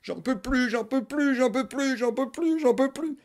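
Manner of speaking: high, desperate pleading; desperate wail. Acted. Mother at the neighbour's door, high desperate wail. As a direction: beg at the door, at the end of her rope.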